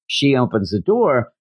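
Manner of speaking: normal talking voice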